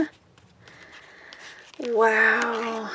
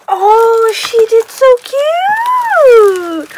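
high, loud, slow gushing squeal; real delight, drawn out high and loud; excited squeal; 10 semitones higher, 13 dB louder, much slower than usual. Real, not acted. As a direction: squeal over a cute gift. Opening a gift on camera.

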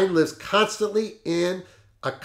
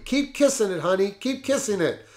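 lightly voiced cry of passion, pitched higher but not loud; a hint of the secretary crying out mid-passion; understated ecstatic outcry; mild mock passionate cry; 5 semitones higher than usual. A narrator's reading. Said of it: "cried out loudly".

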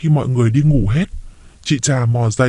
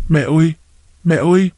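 raised anxious calls of mẹ ơi; calling out; 4 semitones higher than usual. Acted. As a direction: call through the dark house, worried. Daughter-in-law calling mother at night.

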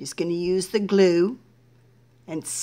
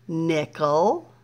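usual speaking voice

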